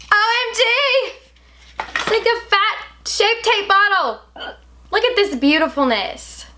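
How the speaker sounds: high, loud excited squeal; gushing over the bottle. Real, not acted. Unboxing new foundation bottle.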